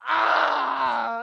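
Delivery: loud, high wail of despair; anguished cry. Real, not acted. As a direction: let out a pained wail. Overwhelmed reaction.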